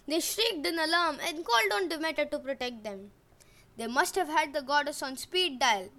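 usual speaking voice

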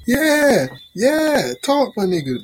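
high, loud hype-man cheer; hyped cheering. Retold or imitated. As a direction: cheer him on loudly. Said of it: "Y'all be like".

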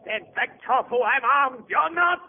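rising, high-pitched threat; panicked warning. Acted. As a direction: warn him off with mounting panic. Armed man warns advancing servant.

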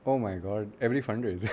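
ordinary delivery

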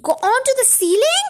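high, rising question; small monkey's astonished question; incredulous cry. A narrator's reading. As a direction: echo it back in mild disbelief. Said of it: "cried one of the small monkeys".

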